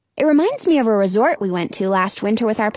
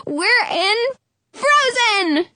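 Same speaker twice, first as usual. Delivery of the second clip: excited shout; 12 semitones higher, slower than usual. A narrator's reading. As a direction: shout it out with glee. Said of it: "I shout".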